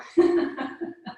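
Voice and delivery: high forced laughter-yoga giggle; loud put-on laugh for laughter yoga. Shown as a demonstration. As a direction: fake a giggle to start the laughter. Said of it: "Just come into a giggle".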